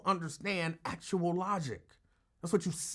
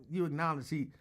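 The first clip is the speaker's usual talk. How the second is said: ordinary delivery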